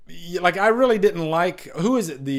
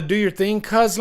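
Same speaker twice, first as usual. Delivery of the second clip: lightly voiced movie character quote; quoting the line; 6 semitones higher, 4 dB louder than usual. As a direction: read the line as a casual quote.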